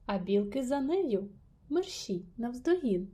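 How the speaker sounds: plain reading voice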